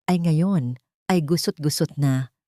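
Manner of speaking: usual speaking voice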